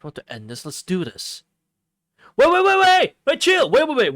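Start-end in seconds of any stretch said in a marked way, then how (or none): 2.4-4.1 high, loud frantic cries of wait; panicked shouting. Real, not acted. Streamer panics as the fight suddenly starts, shouting wait. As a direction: yelp to stop it all at once.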